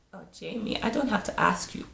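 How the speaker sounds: mildly put-on accent, sassy and dismissive; a half-hearted attempt at her accent; bad imitation of Angela's accent. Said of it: "I know that accent is so bad".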